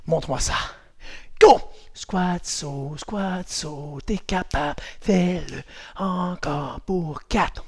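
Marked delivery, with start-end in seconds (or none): 1.9-7.6 hushed, drawn-out workout cues; soft coaching. Real, not acted. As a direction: coach the jumps softly and slowly. Soft coaching of quiet landings. (consignes douces, à voix basse)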